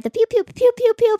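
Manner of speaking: zapping noises shot up high and loud; pew pew back. Pretend. Firing mind beams back at her cohost, loud high pews. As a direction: fire mock laser sounds straight back. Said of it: "What was that?".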